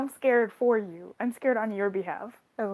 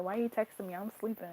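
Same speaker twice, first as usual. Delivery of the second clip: a hint of sleepy sass, trailing softer; mildly annoyed at being woken, dropping quiet; sassy grumpy Jesus; 8 dB quieter, faster than usual. Jesus woken by a text, sassy and softer. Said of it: "He was so sassy".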